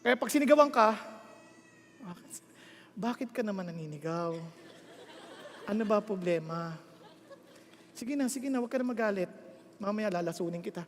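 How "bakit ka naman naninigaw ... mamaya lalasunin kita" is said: very soft, low, placating murmur; mock gentle. Wife answering a shouting husband, mock-gentle and hushed. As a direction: soothe sweetly with a secret threat underneath. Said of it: "Be gentle".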